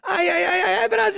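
very high, loud shouted cry; Bolívia catchphrase. Retold or imitated. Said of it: "Bolívia do Desimpedidos".

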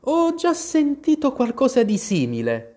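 put-on a curious little girl; Alice. Alice replies. (Alice, voce di bambina)

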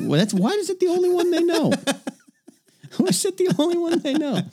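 high, laughing squeal of a question. Real, not acted. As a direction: ask it through laughter.

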